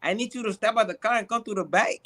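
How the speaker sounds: quick, choppy Spanish-accented English; put-on Spanish accent. Shown as a demonstration. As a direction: imitate a Spanish accent, hurrying along. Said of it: "I just use the accent".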